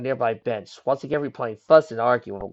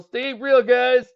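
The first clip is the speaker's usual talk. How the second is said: nervous bravado; 12 semitones higher, 6 dB louder, much slower than usual. Acted. Bully nervously denying vampires.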